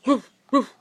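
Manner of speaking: loud playful woof woof; loud mock dog barks. Shown as a demonstration. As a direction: bark like a puppy. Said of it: "animal sound game".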